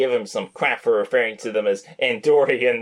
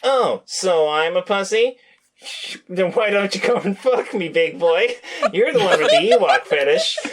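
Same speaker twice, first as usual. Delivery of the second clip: mocking taunt; 7 semitones higher than usual. Heated nerd argument, acted quote.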